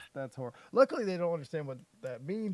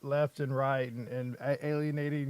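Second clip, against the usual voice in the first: normal talking voice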